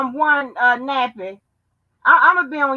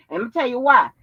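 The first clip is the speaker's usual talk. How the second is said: ordinary delivery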